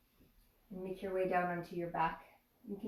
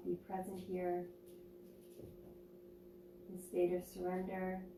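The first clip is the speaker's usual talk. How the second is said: guided meditation; 4 dB quieter than usual. Acted. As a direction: ease them into stillness, unhurried.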